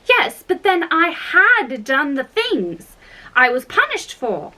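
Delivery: high-pitched little protest, lilting; earnest Alice. Acted. Alice objects to the Queen, high and insistent.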